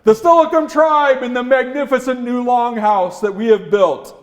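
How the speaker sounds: high, loud ringing call. Acted. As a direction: shout the invitation far and wide. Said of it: "after he completed calling out".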